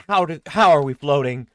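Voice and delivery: astonished loud cry; loud disbelief at floating; amazed. Acted. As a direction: gasp it out in wonder.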